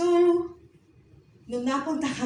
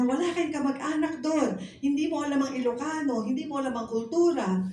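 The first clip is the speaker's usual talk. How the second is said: fast, higher, louder scolding as the angry sister; 7 semitones higher, 3 dB louder than usual. Retold or imitated. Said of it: "lalong nagalit". (mabilis at galit na pagsermon ng ate)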